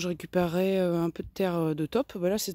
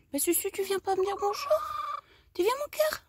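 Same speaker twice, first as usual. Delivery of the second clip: coaxing pet talk; 13 semitones higher than usual. Real, not acted. Coaxing her hen Susu to say hello, very high and pleading. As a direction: coax a shy pet over.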